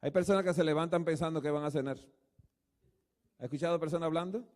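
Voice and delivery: ordinary talk, nothing marked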